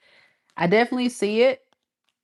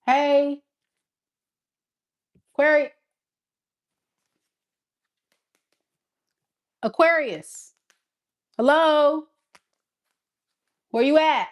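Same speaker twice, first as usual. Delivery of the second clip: calling out; 7 semitones higher than usual. Pretend. Ex calling out for Aquarius.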